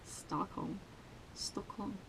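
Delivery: very soft, voiceless Stockholm; whispered repeat. Shown as a demonstration. Swede testing the weird-sounding word on herself, whispered. Trying the word under her breath.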